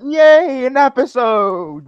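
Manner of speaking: high, loud mock celebration; overdone delighted yay; mock cheer. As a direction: cheer it out, then let the pitch fall. Mock cheer at missed episodes.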